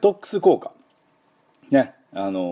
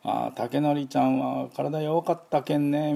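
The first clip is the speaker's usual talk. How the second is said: slow, higher-pitched voice of a relative; drawn-out, higher dialect voice, like fondly recalling a child; imitating a relative; 6 semitones higher, slower than usual. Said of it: "親戚のうちなんか行くと".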